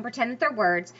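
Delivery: ordinary talk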